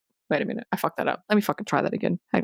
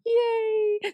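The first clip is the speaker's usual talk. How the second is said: excited cheer. Real, not acted. Celebrating a joke.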